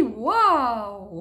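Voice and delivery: loud surprised wow. Acted. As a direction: gasp out the wonder. Character sees odd kite.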